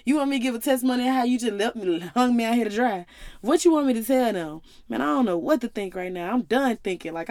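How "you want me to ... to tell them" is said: higher, louder indignant retort; mock-furious rant at God, pitched up and loud; snapping at God. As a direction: throw the questions back at God, furious. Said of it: "Justice was snapping".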